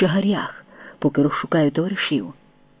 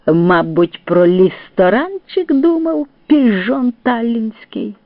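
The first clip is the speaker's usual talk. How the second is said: higher, slow, drawn-out sarcastic telling-off; scolding; 6 semitones higher, 3 dB louder, slower than usual. A narrator's reading. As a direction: scold him, stretching the words mockingly. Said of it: "лаяв орла короб".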